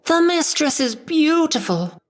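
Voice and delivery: very high little squeak; squeaky Chip. Acted. Chip squeaks praise to his mistress. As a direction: squeak it like an eager servant. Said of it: "squeaked Chip".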